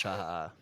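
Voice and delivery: low, soft villain chuckle; mustache twirling villain. Acted. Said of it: "Mustache twirling".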